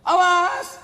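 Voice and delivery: sharp, high shout of warning; stern warning yell at a kicking child; angry shout. Retold or imitated. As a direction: snap at the child to stop. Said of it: "Profesor Susan berkata".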